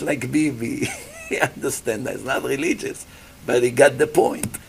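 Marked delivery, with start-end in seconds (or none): none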